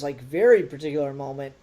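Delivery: usual speaking voice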